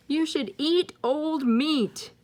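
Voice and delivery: high, slow vulture voice. Acted. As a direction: croak it like a vulture. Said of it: "it's a vulture".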